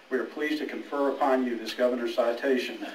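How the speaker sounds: ordinary talk, nothing marked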